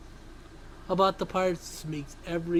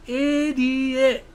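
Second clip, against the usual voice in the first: scaled-down, slightly raised yell; small yell; 4 semitones higher, 5 dB louder than usual. Shown as a demonstration. Host offering a fair enough yell, small and held-in. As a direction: give a contained little yell. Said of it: "There's a fair enough yell for you that's in a small manner".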